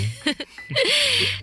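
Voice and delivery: high light giggle; playful giggle. Acted. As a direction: giggle sweetly at your partner. Teasing couple banter. (malambing na hagikgik)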